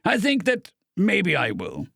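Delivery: slow and deliberate on think; slow, thoughtful. A narrator's reading. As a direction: think it over aloud. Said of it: "began slowly".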